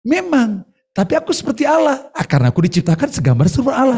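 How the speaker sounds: high, bright, sure answer; confident reply. Retold or imitated. Eve answering the devil, high and confident. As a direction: answer the tempter with calm confidence. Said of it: "Hawa bilang gini".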